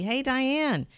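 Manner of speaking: high called-out greeting from afar; mock shout. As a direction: call out to her across the yard. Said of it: "he shouted at me".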